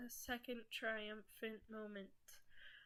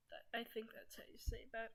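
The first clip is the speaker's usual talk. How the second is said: whispering; 7 dB quieter, faster than usual. Real, not acted. Whispered aside about a word.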